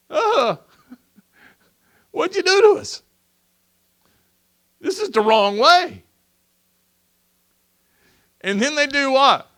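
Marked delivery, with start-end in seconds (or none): 0.1-6.0 much higher, laughing, squeaky confused voice; bewildered Israelites. Acted. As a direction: play the baffled crowd turning on Moses.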